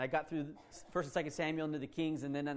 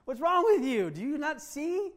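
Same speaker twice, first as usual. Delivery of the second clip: higher, louder scolding; Jesus scolding Ryan to wake up, higher and louder; wake-up call; 8 semitones higher, 6 dB louder, slower than usual. Acted. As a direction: slap him awake with words.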